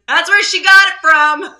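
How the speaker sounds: husband's triumphant jab; husband's gotcha. Retold or imitated. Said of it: "My husband goes".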